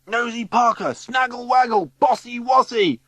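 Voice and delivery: high, loud playground name-calling; shrieking taunts. A narrator's reading. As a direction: shriek it like jeering children. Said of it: "voices shriek".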